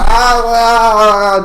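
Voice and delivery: high, loud mock cries of pain; hammed-up pained yelps; imitated cries. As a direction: yelp it out like a man stung in the eyes. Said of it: "He's just like".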